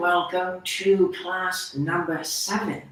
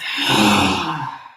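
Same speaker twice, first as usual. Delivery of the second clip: long, low, audible sigh out through the mouth; big exhaling sigh; 7 semitones lower, 5 dB louder than usual. Shown as a demonstration. Meditation teacher showing the class how to exhale, big sigh. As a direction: let out a big breathy exhale. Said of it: "big exhale out of the mouth".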